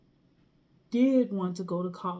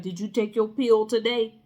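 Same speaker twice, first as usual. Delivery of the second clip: put-on demanding boyfriend; 7 dB louder than usual. Boyfriend nagging about the pill.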